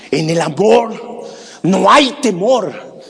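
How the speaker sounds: preacher lifts the phrase slow and strong; swelling refrain. Acted. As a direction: proclaim the refrain.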